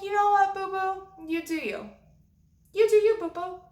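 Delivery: high-pitched, sassy brush-off; hammed-up high, condescending send-off; mock-sweet dismissal. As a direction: pitch it way up to wave a friend off. Acted dismissal of her friend.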